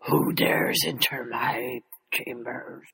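low, slow, whispered menace; dragon voice. Acted. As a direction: breathe it out like a looming dragon. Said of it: "That's awesome".

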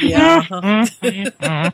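slow, drawn-out doubtful agreement; unconvinced Remus. Retold or imitated. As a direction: stretch it out like you don't buy it. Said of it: "Remus is just like".

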